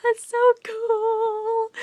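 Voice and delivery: giddy high-pitched cry of delight; excited squeal. Retold or imitated. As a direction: shriek like a thrilled kid at a bonfire. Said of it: "and I'm just like".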